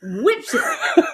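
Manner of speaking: loud, high, talking through laughter; real laughter breaking into the words; laughing hard. Real, not acted. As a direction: push the words out while cracking up. Both cracking up over watermelon feat.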